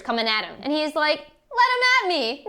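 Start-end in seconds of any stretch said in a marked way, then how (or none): 1.5-2.4 high, brash taunt; cocky defiance. Acted. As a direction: dare them to come. Macbeth defiant.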